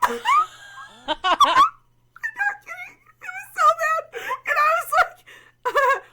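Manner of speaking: high, squealing laugh through the words; losing it, laughing while talking; real laughter. Real, not acted. Recalling the toe request, helpless high laughter.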